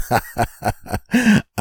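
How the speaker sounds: high acted cackle; gloating cackle. Invisible husband watching wife with the kids, high gloating cackle.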